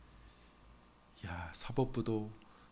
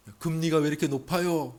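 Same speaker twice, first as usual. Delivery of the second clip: higher, louder retold challenge; mock protest; 5 semitones higher, 8 dB louder than usual. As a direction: demand an answer, lightly acted. Acted protest about interest rates. (따져 묻는 흉내, 높고 크게)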